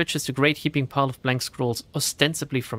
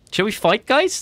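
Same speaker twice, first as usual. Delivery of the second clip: eager call; 7 semitones higher, 5 dB louder than usual. Real, not acted. Rallying viewers for a fight.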